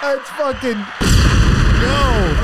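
words squeezed out high, mid-laugh; laughing through words. Real, not acted. Telling a friend about the prank, still laughing. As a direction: try to talk while still cracking up.

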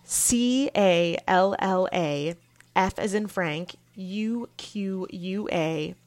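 each letter said slowly and apart; slow spelling out. Shown as a demonstration. Podcaster spelling her email for listeners, slow and clear. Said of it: "I'm gonna spell that out".

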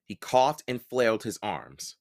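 usual speaking voice

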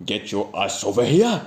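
slow, put-on Japanese accent; imitated Japanese accent, drawn out; Japanese-accented English. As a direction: bark slowly in mock Japanese-accented English. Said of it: "με την ιαπωνική του προφορά του λέει".

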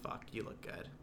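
hushed, low whisper to oneself; whispered self-praise. Acted. Morning pep talk in the mirror, whispered. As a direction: whisper it admiringly at your reflection.

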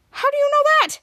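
high, loud disbelieving question; incredulous question. Retold or imitated. Ray questions Henry's knowledge, high-pitched disbelief. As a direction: demand it in astonishment.

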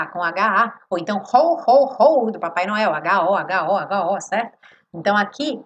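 ordinary delivery

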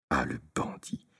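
juron chuchoté, plus grave; colère chuchotée. Acted. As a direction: souffler sa rage tout bas. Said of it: "en secouant ses grelots avec fureur". (la mule furieuse chuchote contre Tistet)

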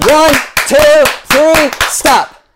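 high, loud shout to stop the noise; shouted countdown. Real, not acted. Pastor stopping the congregation's storm noise, loud shout. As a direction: bark the count over the din. Cutting off the storm sound game.

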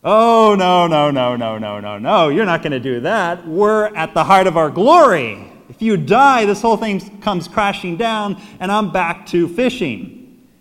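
loud, high, panicked protest; mock outraged refusal; Peter. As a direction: object in hammed-up alarm, starting on a run of noes. Acts Peter protesting Jesus' death.

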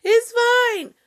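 put-on very high, loud, slow squeaky kid brush-off; a caught kid insisting all is fine; guilty kid. Kid caught by mom, squeaky high loud denial. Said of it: "when you're a kid and you do something".

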